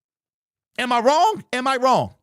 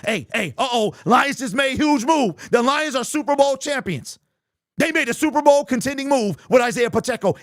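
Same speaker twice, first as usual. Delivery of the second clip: mock hype reporter; 4 semitones higher than usual. Shown as a demonstration. Mocking hype channels for his chat, fake breaking-news excitement. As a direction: parody a hype-man Lions update. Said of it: "Is that what you want me to do?".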